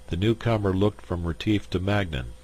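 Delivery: ordinary delivery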